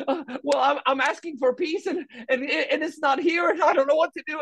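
high, fretful mock complaint; a hammed-up helpless voice; mock helpless whine. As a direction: whine it like someone lost. Mocking confused people.

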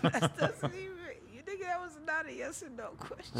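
high, fast, laughing rant; laughing exasperation. Real, not acted. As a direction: laugh through the frustration. Laughing at son.

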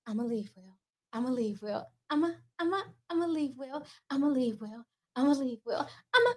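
quietly repeating the line; soft requested catchphrase; performing the catchphrase on request. Pretend. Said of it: "but can you say, 'I'ma leave Will'?".

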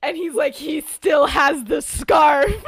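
high, loud, slow disbelieving cry; excited shout. Acted. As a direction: belt it out like you can't believe your eyes. Sirius spotting Jeffrey, story climax.

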